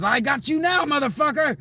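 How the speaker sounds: menacing tick voice shouting; voicing a tick. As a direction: shriek like a tick coming for you. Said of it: "He says".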